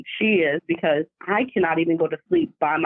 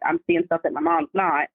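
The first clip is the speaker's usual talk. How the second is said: ordinary delivery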